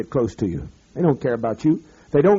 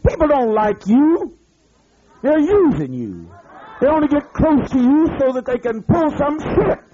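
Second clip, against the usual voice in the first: preaching at full force; 11 semitones higher than usual. Real, not acted. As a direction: pound each word at the listeners. Preaching rant resumes.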